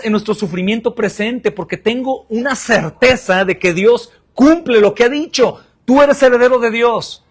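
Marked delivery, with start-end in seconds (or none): 1.5-7.2 preaching exclamation. Acted. Predicador exclama ante la congregación.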